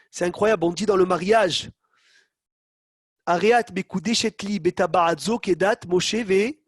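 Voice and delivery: normal talking voice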